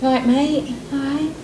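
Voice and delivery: put-on playful Essex accent; broad Essex greeting. Echoes in regional voice.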